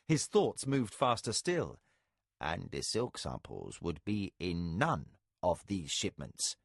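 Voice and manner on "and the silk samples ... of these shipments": a low, slow, measured voice for Arakasi; lightly voiced calm spy, slow and deliberate; understated low character voice. A narrator's reading.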